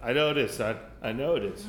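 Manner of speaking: low, soft fatherly reassurance; hushed, halting comfort for a son; consoling father. Acted. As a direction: talk a crying kid down quietly. Father consoles crying son, acted.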